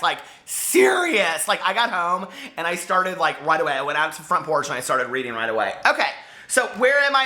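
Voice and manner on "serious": "serious" said high and drawn out; emphatic. Real, not acted. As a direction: really lean on "serious". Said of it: "I was serious, you guys".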